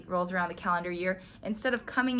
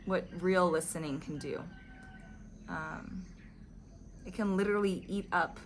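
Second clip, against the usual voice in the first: ordinary talk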